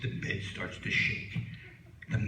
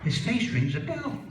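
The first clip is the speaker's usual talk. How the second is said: rising into a high puzzled voice; unsure, reaching for a memory; puzzled quote; 6 semitones higher, 4 dB louder than usual. Acted. Comic puzzling over a half-known face, rising high.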